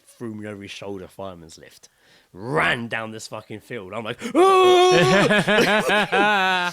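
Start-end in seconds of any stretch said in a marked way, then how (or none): 4.4-6.7 high, loud scream through laughter; laughing scream. Retold or imitated. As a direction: shriek and laugh at once. Thrown over shoulder, running.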